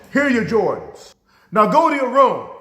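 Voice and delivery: loud, high, emphatic command; stern mother. Retold or imitated. Mother handing over hand-drawn Jordans, acted angry order. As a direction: dismiss the child as a fed-up mom.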